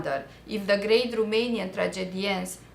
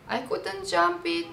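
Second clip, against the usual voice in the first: pitched-up pleading protest; retold plea; 8 semitones higher than usual. To the examiner at the exam, a high pleading protest. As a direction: plead your case, voice lifted high.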